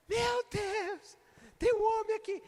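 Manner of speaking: high, panicked retelling of a terrified wife; a wife seeing a man at the bed, mock terrified; frightened cry.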